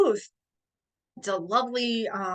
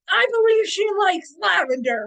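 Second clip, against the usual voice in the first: loud, high, hammed-up bellow; pompous booming knight; booming lord; 9 semitones higher, 7 dB louder than usual. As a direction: announce it grandly to the whole field. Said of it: "And he goes".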